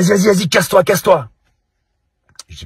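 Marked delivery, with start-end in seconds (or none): 0.0-1.3 high, loud furious get-lost shout; angry brush-off. Retold or imitated. Grumpy stranger shouts at Ragnar to clear off, angry and loud. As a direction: shove him away with your voice. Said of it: "Et là il me regarde, il fait".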